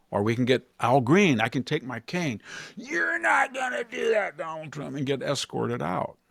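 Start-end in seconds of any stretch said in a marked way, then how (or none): 2.8-4.9 high mock shout at Trump; mock heckler shout. Congressman heckling Donald Trump, high mock shout.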